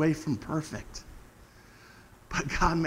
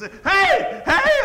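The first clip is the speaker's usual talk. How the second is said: very high, loud calls of "Hey!"; repeated yell; 12 dB louder than usual. Retold or imitated. Preacher reenacts yelling for people's attention, high shout. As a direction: shout it twice across the field. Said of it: "I was trying to get people's".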